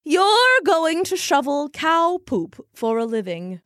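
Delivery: grand soothsayer, pleased with herself; fortune teller voice. Acted. Madame mocking Randy.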